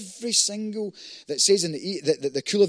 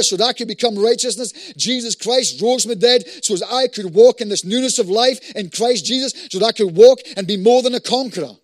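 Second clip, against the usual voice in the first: loud, driving preaching with raised pitch; fired-up declaration; 5 semitones higher, 9 dB louder than usual. Real, not acted. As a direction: build into a forceful faith proclamation. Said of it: "When I start speaking like that".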